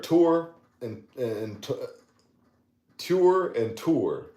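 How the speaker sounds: tour said like tower, slow and drawn out; saying tour. Shown as a demonstration. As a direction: stretch tour into two beats, slowly. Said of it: "But the next word".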